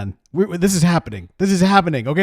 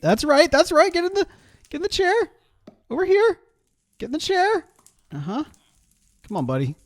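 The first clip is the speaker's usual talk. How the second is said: coaxing; 12 semitones higher, 4 dB quieter than usual. Acted. Coaxing a character into chair.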